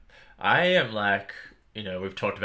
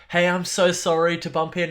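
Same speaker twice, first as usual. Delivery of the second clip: apologetic; 9 semitones higher, 5 dB louder than usual. Retold or imitated. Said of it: "it's like".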